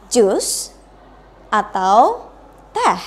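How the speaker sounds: "Jus" rising, "atau" rising, "teh" falling at the end; choice-question intonation. Shown as a demonstration. Teacher showing students a choice question, rise then fall. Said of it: "harus menggunakan raising intonation".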